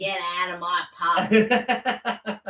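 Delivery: slow, drawn-out London landlady; Peggy Mipp. Acted. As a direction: stretch every word like a Cockney pub landlady. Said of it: "I only think of Peggy Mipp".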